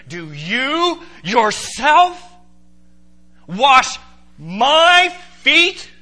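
slow, loud, high, word by word; stunned question, every word stretched and punched; emphatic disbelief. Acted. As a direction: lean hard on YOURSELF, MY and FEET with rising pitch. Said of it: "an absolutely emphatic".

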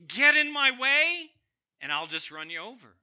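loud, high bark then fast quick finish; fierce shout, then a brisk threat; defiant challenge. Shown as a demonstration. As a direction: dare anyone to block you. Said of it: "we might say it this way".

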